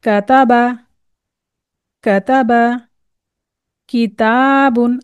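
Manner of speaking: किताबुन slow with long taa; careful Quran reading; word reading drill. Shown as a demonstration. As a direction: read each word slowly, letter clear. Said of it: "मुरक्कबात देख लेते हैं".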